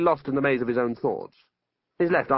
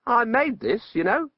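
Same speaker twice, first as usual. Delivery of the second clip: higher-pitched proud wizard; lightly voiced wizard; 11 semitones higher, 3 dB louder than usual. Wizard's speech in story.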